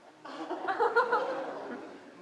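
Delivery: high, fond chuckle; real delighted laugh; warm laugh. Real, not acted. Touched by boy's answer.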